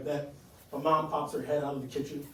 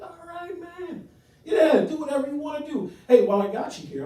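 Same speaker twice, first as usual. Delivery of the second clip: high-pitched uncle brushing it off; boisterous uncle; 9 semitones higher, 4 dB louder than usual. Acted. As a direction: play the uncle, pitched well up. Uncle's reply, acted.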